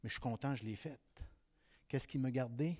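ordinary delivery

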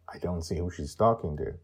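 plain reading voice

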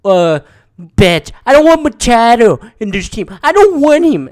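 imitating a kid ranting at the radio; whiny kid rant. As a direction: yell it like a little kid fed up with his team. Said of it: "He's like".

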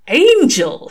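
high, loud gleeful shock; scandalized delight. A narrator's reading. Crowley gapes at the angel, scandalized delight. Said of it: "in tones of scandalized delight".

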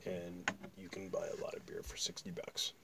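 hushed aside; whispering. Real, not acted. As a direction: keep it to a whisper.

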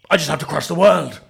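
ordinary delivery

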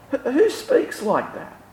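high, hushed bafflement; incredulous. Acted. Baffled reaction to the claim.

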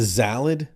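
"salad" said as "zalad". Acted. Mock customer ordering, "salad" with a Z. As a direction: put a buzzing Z on "salad". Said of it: "they put a Z in front of everything".

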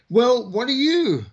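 high, slow drill-sergeant demand; mock bellow. Sergeant major demanding of the four leftover recruits, slow high mock bellow. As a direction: play the sergeant major barking at stragglers. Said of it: "the sergeant major bellowed".

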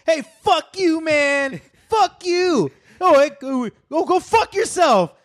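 very high hammed-up yell; imitating a ranting stranger. Friend mimics the woman cursing out the worker, very high. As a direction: spit back insults in a squeaky put-on voice. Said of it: "the woman yells back".

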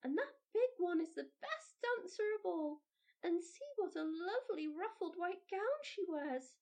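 put-on high, eager child chattering; a small girl admiring the biggest kernel; child naming what she sees, thrilled; little girl's voice. A narrator's reading. Mildred keeps talking.

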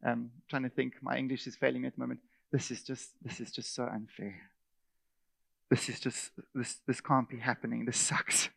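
quiet muttering complainer; a hint of a sulky whiner, soft; mock self-pity. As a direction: grumble under your breath, sorry for yourself. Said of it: "to start to go".

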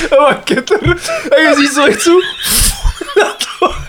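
can barely get the words out for laughing; laughing through it. Real, not acted. [name] gleefully recounting the Joe Pesci scene, laughing.

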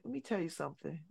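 dropped low and hushed, make them lean in to hear; quiet menace, an octave down, barely above a murmur; hushed serial-killer calm. Retold or imitated. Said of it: "started talking in my low serial killer voice".

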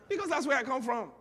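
high, loud burst; emphatic exclamation. Real, not acted. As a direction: shout it out as a proud declaration. Preacher exclaims his heavenly origin.

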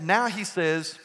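normal talking voice

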